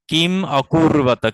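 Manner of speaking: কিম অকুর্বত said slowly with a break between the words; slow split কিম অকুর্বত; separated words. Shown as a demonstration. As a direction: pause after কিম, then অকুর্বত slowly. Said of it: "যখন আপনি অকুর্বত আলাদা করে উচ্চারণ করবেন".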